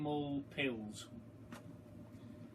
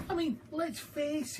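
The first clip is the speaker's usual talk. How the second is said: a hint of a cheeky boy, higher; lightly voiced boy George; 13 semitones higher than usual. A narrator's reading. Reader voicing George scheming to himself, lightly boyish. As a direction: read George's line a little boyish.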